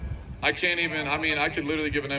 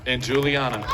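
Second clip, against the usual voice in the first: ordinary talk, nothing marked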